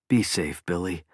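hushed and low, a thought not spoken; soft inner voice. A narrator's reading. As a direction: murmur it like a private wish. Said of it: "Dan thought".

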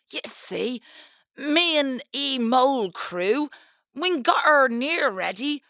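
rustic west country mole burr; west country accent. Acted. Mole speaks dialect.

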